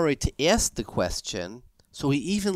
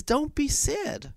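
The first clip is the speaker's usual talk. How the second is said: comforting; 12 semitones higher, slower than usual. Acted. Yosef comforting brothers.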